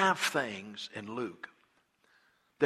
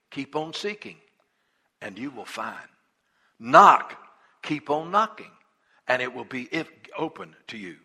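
scripture reading. Acted. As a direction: read the promise with weight. Reads scripture aloud.

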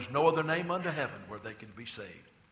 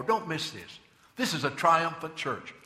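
ordinary delivery

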